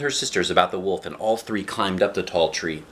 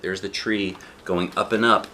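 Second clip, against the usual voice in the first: ordinary delivery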